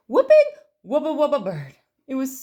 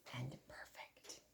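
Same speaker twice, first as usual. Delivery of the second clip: whispered; 9 semitones lower, 28 dB quieter, slower than usual. A narrator's reading. Bear looms over penguins.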